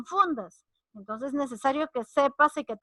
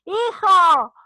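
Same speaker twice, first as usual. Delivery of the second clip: loud, higher call to a son; mamá llamando; 6 semitones higher, 10 dB louder than usual. Acted. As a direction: call out for your child. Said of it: "Cuando mamá te llama". (llamado fuerte de mamá)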